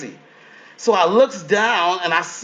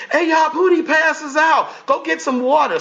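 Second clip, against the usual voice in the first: mock panicked shout; 8 semitones higher than usual. Cast calling for water when he faints, mock shout. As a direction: yell for help as the cast. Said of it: "And they're like".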